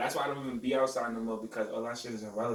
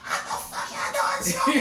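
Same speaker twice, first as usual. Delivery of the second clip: high, slow mock scolding; loud high mock auntie scold; angry aunt; 6 dB louder, slower than usual. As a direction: play the aunt going off on her kid. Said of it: "she's like".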